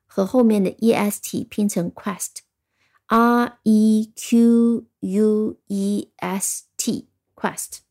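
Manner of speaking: 极慢地拼出E Q U E S T，再读quest; 一个个字母拖慢拼读quest; very slow spelling. Shown as a demonstration. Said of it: "注意Q U的发音". (非常缓慢地拼读quest)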